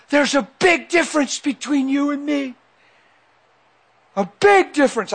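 high, insistent imitated protest; upset yell. Overwhelmed son arguing with dad, imitated high-pitched outburst. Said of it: "screaming at me".

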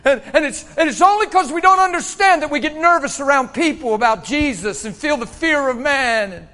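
loud, high, urgent preaching; full-force preaching. Real, not acted. Preacher urging congregation past fear of man, full-force preaching.

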